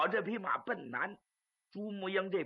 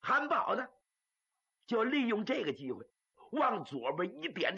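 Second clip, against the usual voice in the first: usual speaking voice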